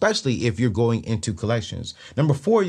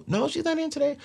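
high, mild office receptionist voice; 4 dB quieter, faster than usual. Retold or imitated. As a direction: answer like a bored front desk. Receptionist answering the collector.

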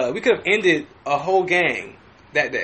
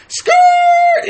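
loud mock skid squeal; shrill imitated tire skid; tire screech; 8 dB louder than usual. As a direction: yell the screech of the tires. Tires screeching in retold chase.

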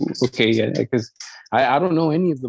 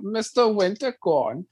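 slow, raised-pitch mock German accent; 6 semitones higher, slower than usual. Shown as a demonstration. Co-host doing a German voice on request, saying the CEO's name. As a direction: put on a drawn-out German voice for the name. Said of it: "can you do a German voice real quick".

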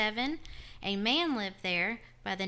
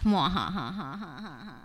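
mock-sinister mwahaha laugh in a long wobbly run; cartoon villain laughing over a spooky title; evil laugh; 3 semitones lower than usual.

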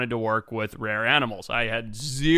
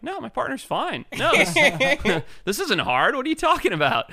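incredulous denial; 12 semitones higher than usual. Retold or imitated. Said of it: "I'm like".

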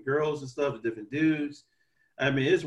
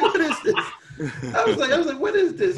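laughing disbelief; 13 semitones higher, 5 dB louder than usual. Retold or imitated.

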